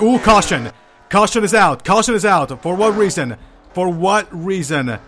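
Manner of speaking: loud, high excited call; excited shout. Real, not acted. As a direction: yell it out as the caution flies. Caution flag comes out mid-race.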